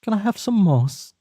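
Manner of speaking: high, fast pleading urchin voice; Oliver Twist imitation. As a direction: beg like a hungry orphan. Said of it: "how's Oliver Twist say it".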